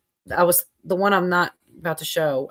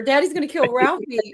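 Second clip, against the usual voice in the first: put-on kid's line; 7 semitones higher than usual. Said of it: "whenever he's like".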